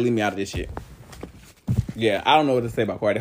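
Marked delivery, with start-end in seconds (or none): 0.0-0.7 much lower, quiet flat dismissal; a woman waving off the drama, low and quiet. Retold or imitated. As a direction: drop your voice low and wave it off. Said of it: "she's like".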